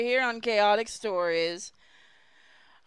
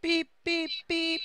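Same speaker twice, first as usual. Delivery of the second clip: higher beeping robot; 6 semitones higher than usual. Acted. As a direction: chirp it like a machine. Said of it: "said the car in a robot voice".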